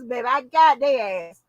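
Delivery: ordinary talk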